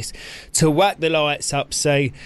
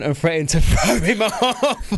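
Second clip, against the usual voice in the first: laughing. Real, not acted. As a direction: break into laughter mid-sentence. Host laughing while recounting the threat.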